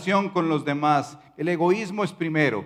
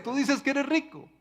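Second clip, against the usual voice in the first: much higher, pointed repeat of the rebuke; rising rebuke; 11 semitones higher than usual. Acted. The Lord presses the church again, much higher. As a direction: push the accusation up in pitch. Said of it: "como diría nuestro Señor en Apocalipsis".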